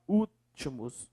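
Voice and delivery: "Últimos" slowly, the first syllable Ú held apart; stress on Ú. Shown as a demonstration. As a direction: draw out the Ú of "Últimos", then finish softly. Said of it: "é o U aqui".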